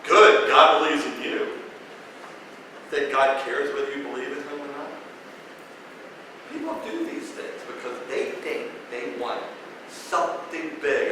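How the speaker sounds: ordinary talk, nothing marked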